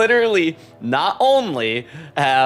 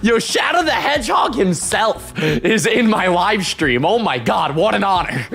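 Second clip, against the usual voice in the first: excited shout; 6 semitones higher, 3 dB louder than usual. Real, not acted. Excited greeting of a viewer.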